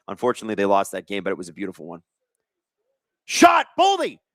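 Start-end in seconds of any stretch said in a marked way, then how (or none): 3.2-4.2 high, urgent play-by-play shout; sudden commentator burst; excited shot call. Real, not acted. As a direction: call the shot as it flies, pitched way up. Calling a shot on goal live.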